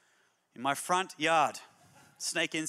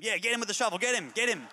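rising excited yells at the plumber; egging him on, getting more and more worked up; frantic cheering of a snake hunt; coaching from the side; 6 semitones higher than usual. Retold or imitated. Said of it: "I was more coaching him".

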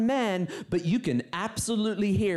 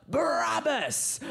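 demo shout; 7 semitones higher, slower than usual. Shown as a demonstration. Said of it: "you are to shout out".

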